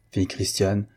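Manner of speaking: ordinary delivery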